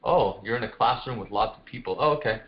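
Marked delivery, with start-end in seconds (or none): none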